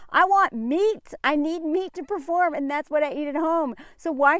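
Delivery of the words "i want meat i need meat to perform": slow mock-whiny athlete; whiny demand. Athlete mockingly demanding meat, slow whine. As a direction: drag it out like a spoiled athlete.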